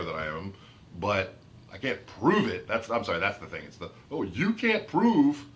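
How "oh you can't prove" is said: high, drawn-out mock challenge; slow it right down and pitch it up in mockery; mocking objection. Objector challenging the speaker's proof, mocking.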